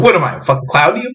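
mock Pesci menace, rattled off quickly; Joe Pesci impression. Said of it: "He's not your Joe Pesci".

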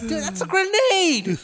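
high, incredulous protest through laughter; mock exasperation. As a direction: scold a teammate in disbelief, half laughing. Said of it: "it was like".

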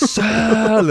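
high shouted single word; hyped yell. Retold or imitated. Boss yelling at his salespeople to sell. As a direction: bark the order at the sales floor. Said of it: "Jag kastar ut en klocka".

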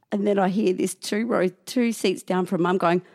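ordinary talk, nothing marked

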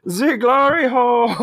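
high, loud, slow put-on German voice; mock German gasp. The Germans awed by the double team, slow and high. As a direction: marvel at it in a hammed-up German voice. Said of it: "the Germans react, hammed up".

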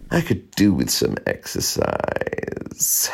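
low, very slow cat drawl; lazy cat. A narrator's reading. Cat on the radiator wanting exercise, lazy. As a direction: stretch every word out languidly.

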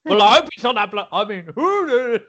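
high, loud, fast mimicry breaking into laughter; mock his sudden voice change, pitched right up; changed voice. Teasing co-host's sudden change of voice, high mocking imitation. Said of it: "notice how that voice changed".

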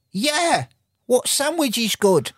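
high-pitched mock Australian, drawn out; Australian accent. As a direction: put on a high, slow Australian voice. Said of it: "I could do an Australian".

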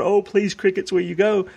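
mildly mock-tearful begging; mock pleading. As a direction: imitate a whiny plea to the crickets, half-hearted. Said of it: "play this game and cry about it".

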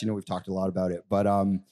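ordinary talk

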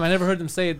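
ordinary talk

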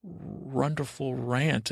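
slow, lightly voiced cartoon dog; a hint of talking-dog voice, slowed right down; Scooby-Doo. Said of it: "as Scooby-Doo writes say".

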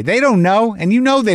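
exasperated insistence. Acted. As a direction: insist on it, fed up. Rebutting empty reassurance.

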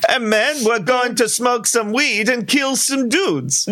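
high-pitched, badly faked Caribbean voice; mock Caribbean accent. Podcaster retries the accent after co-host's teasing, mock Caribbean accent. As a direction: ham up a clumsy Caribbean accent. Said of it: "That's Caribbean".

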